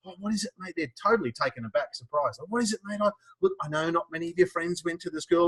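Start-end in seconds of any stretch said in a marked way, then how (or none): 0.0-0.7 much softer, gentle parent voice; soft comforting parent. Retold or imitated. Parents gently asking their upset son, soft and tender. 2.5-5.5 much softer, gentle parent voice; soft comforting parent. Retold or imitated. Parents gently asking their upset son, soft and tender.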